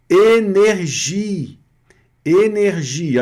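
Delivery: énergie said slowly, closed É at the start; French pronunciation. Shown as a demonstration. Teacher modelling the French word energia. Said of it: "olha o E com acento".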